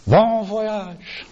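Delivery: high, buoyant send-off; imitated old man's merry farewell. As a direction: call it out with a cheery lift. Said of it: "wishing her this buoyant".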